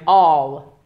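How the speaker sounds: strong model "All", open vowel held long; dropped-throat vowel. Shown as a demonstration. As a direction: drop your throat and sound "All" fully. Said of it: "You have to drop your throat".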